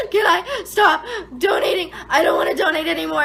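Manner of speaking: high, loud gasping plea; imitating a tearful, breathless panic; retold panic attack. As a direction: gasp between words as if hyperventilating. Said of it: "I'm having this panic attack".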